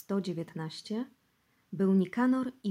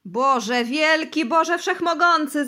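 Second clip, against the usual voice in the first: slow, loud, high cry to God; loud pleading prayer; 8 semitones higher, 10 dB louder, slower than usual. Acted. Bosy cries out to God before the interrogators. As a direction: wail to heaven in despair. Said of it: "zaczął mówić Bosy".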